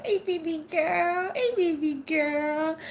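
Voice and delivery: high singsong hey; excited greeting. Real, not acted. Welcoming a dear friend back, high excited coo.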